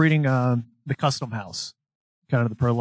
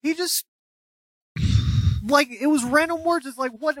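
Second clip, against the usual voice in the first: way higher, disbelieving; incredulous; 13 semitones higher than usual. Real, not acted. Telling friend about the weird kid, much higher and incredulous. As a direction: pitch it up in disbelief.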